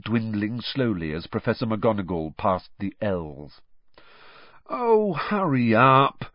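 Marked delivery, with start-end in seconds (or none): 4.7-6.3 slow, higher-pitched whine; drawn-out hungry moan. A narrator's reading. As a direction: groan it impatiently. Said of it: "Ron moaned".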